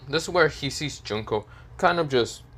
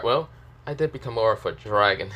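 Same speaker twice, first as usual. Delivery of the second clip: usual speaking voice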